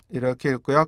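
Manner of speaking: ordinary talk, nothing marked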